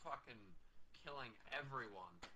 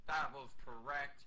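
much louder, slow and proud; smug agreement; 4 semitones higher, 10 dB louder than usual. Pretend. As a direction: proudly own the deed. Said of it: "and I'm like".

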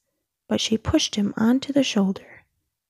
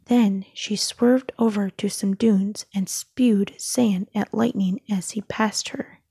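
plain reading voice

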